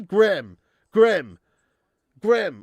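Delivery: "Graham" as one short syllable, grem; American Graham. Retold or imitated. Streamer imitating American speech, "Graham" as a one-syllable grem. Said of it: "What do they say?".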